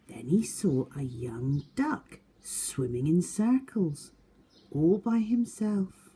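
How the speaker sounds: put-on lively read-aloud for young children; story narration. A narrator's reading. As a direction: tell it to a class of seven-year-olds. Reads picture book aloud.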